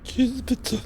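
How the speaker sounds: squeaky voice sucked in on the breath; high inhaled meme voice. Retold or imitated.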